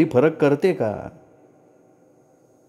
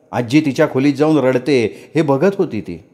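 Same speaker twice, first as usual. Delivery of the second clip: ordinary delivery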